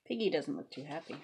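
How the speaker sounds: usual speaking voice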